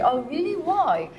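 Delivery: ordinary delivery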